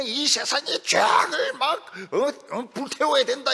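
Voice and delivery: loud, high and slow fiery cry; mock hellfire bellow at the flock; shouting preacher. Preacher yelling at the congregation to burn away sin, hammed-up shout. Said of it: "소리를 막 지르면서".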